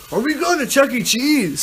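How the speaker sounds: high, eager quoted question; playing up a friend's childlike excitement; excited friend. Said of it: "apparently he said".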